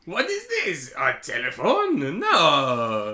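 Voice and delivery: put-on baffled French accent rising into outrage; Napoleon. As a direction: squeal at the phone, then a firm no. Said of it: "he spends the entire movie being like".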